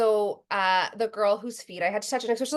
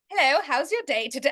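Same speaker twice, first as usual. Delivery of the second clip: British attempt slipping toward Australian; bad British accent; 4 semitones higher than usual. Shown as a demonstration. Second try for a friend, badly missed British accent. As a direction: have a clumsy go at a British accent. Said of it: "A British accent".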